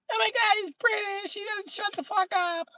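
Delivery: very high falsetto squeal; hammed-up falsetto girl; squealing fan girl. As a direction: shriek it like an excited groupie. Women fawning over British man.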